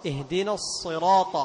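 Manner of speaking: الصراط slowly with a heavy emphatic sad. Shown as a demonstration. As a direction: press the tongue up for a full sad in الصراط. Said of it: "لاحظ إطباق".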